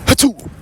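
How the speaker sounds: fake sneeze. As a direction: sneeze straight at them. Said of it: "Round two of this bit".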